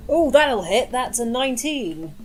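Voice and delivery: ordinary delivery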